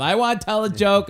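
put-on a kid butting in. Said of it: "the kid starts heckling him".

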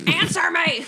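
high-pitched demanding yell; hammed-up desperate demand; acted yell. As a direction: yell it high, half laughing. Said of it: "and I just yell".

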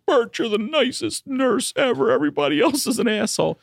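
very high, gushing mock flattery; hammed-up high sweet-talking patient; fawning junkie. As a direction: gush to the favorite nurse in a squeal. Acts out junkie flattering the nurse.

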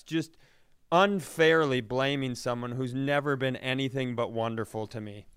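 usual speaking voice

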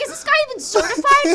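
high, loud, fast disbelief; acted alarm. Daughter doubting the doctor, acted alarm. Acting out her alarmed reaction.

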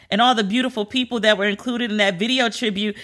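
normal talking voice

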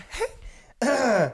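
very high, slow puppet voice; Elmo impression. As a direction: squeak it like a furry red puppet.

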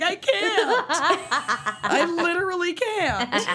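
high, laughing I can't; laughing despair. Pretend. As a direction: whine it through laughter. Acting out reaction to messages.

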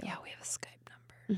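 hushed secret whisper. Pretend.

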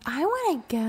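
a hint of an eager toddler asking to come; mild imitation of a small daughter; little girl voice. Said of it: "Bronx is like".

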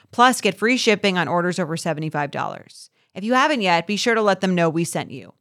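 upbeat sponsor read. Acted. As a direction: read it like a host ad. Host reads sponsor ad.

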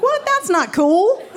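high, mock-indignant objection; comic dismay, pitched high; retold dismayed protest. Retold protest at date leaving.

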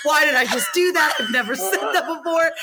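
high, appalled self-scolding; mock mortified outburst. Guest reliving her podcast blunder, mortified and high-pitched. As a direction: cry out in exaggerated disbelief at yourself.

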